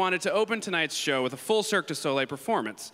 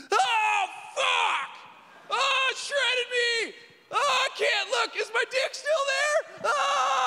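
high pained screaming; Brad Pitt impression; slower than usual. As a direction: shriek in agony, leg caught. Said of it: "my impression of Brad Pitt getting his leg stuck in a jet ski".

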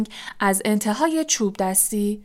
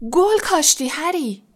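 high, mildly raised cheer; cheering shout; 7 semitones higher than usual. A narrator's reading. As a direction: call out praise, pitch lifted.

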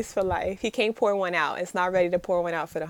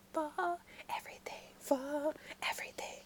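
soft breathy whisper, barely voiced; 6 semitones higher, 16 dB quieter than usual. Recalling the show's tender line, whispered. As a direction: hush it like a secret.

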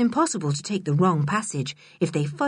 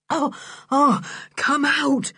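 gasping with laughter; 7 semitones higher than usual. A narrator's reading. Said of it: "gasped Julian".

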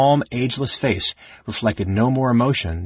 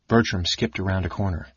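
ordinary delivery

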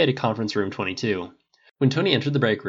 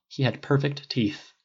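ordinary delivery

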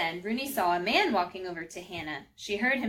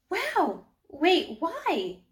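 much higher and loud, thrilled; excited; 9 semitones higher, 5 dB louder, much slower than usual. Acted. Rooney thrilled by the vision of Hannah's baby: high excited cry. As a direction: burst out in wonder. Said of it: "Rooney was so excited".

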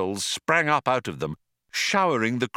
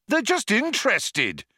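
shouted; 12 semitones higher than usual. A narrator's reading. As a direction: call it out over the tension. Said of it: "shouted Zaphod".

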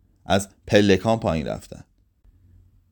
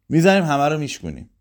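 quick, eager, a bit higher; eager plan; 5 semitones higher, faster than usual. A narrator's reading. Pepsi urging Mansour to smash every bottle, fast and eager. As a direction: rush it out, all fired up.

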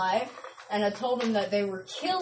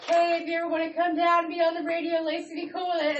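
mom's message; 6 semitones higher, faster than usual. Retold or imitated.

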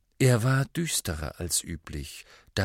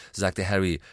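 ordinary delivery, nothing marked

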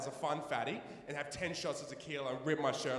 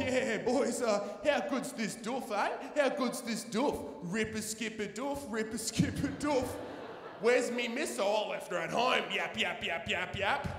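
higher, mock ocker Australian blokey voice; try-hard bloke talk; 5 semitones higher than usual. Nervous guy faking ocker Aussie talk to blokes. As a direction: ham up the Aussie bloke to fit in. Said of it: "I just try and talk like them".

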